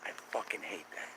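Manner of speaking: disgusted whispered aside; muttered whisper. Real, not acted. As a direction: breathe it out under your breath. Disappointed it's a plane.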